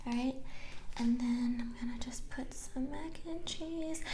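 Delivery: soft whispered aside over the food; mukbang whisper. Real, not acted. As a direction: murmur it close to the mic, unvoiced. Quiet aside while eating.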